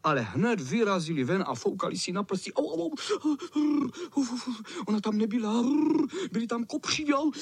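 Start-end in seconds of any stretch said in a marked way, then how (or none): 2.5-3.9 high, slow yelps of pain; pained ow cries. A narrator's reading. Boys yelping after stinging their fingers. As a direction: stretch out each ow, high and hurting. Kids burn fingers on nettles.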